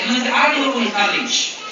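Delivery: ordinary delivery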